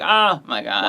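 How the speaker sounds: mock groan of dismay; imitated regretful groan. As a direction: groan it out, mimicking a co-host's regret. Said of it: "I could hear your groan of regret".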